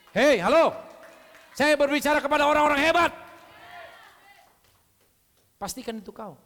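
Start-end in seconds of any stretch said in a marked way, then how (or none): 0.1-3.1 loud, high, ringing shout to the room; shouting call-out. Real, not acted. Preacher shouting to the brave ones in the congregation. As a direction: call out to the whole hall at full voice.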